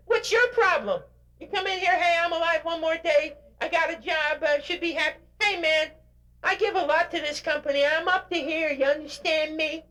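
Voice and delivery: high, whiny mock complainer voice; complaining employee. Speaker mocks an employee complaining about his job, in a high whiny mock voice. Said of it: "This person said".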